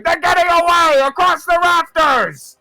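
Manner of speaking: high, full-voiced yell; guards' alarm shout. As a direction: raise the alarm as thieves escape. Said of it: "hear several guards going".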